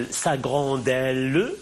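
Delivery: "sa grandelle" very slowly, ending in a sounded "le"; pronounced mute E. Shown as a demonstration. Teacher showing the E is pronounced, final E sounded in "grandelle". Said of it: "Il est prononcé".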